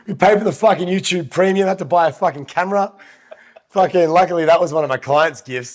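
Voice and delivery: blocked-nose nasal tone. Real, not acted. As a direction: speak through a stuffy nose. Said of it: "With my nasally voice right now".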